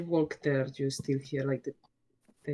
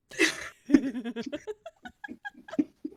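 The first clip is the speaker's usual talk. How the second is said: high laugh; 11 semitones higher than usual. Real, not acted. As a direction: let a high laugh break out. Laughs after accent bit.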